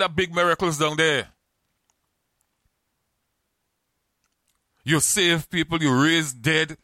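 mocking thief. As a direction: taunt him like the thief on the cross. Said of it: "the man on the cross who was teasing Jesus".

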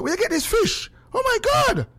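high, rising shriek of surprise; mock surprised squeal; surprised exclaim. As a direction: gush with amazement over the fish. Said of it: "she call my wife and say".

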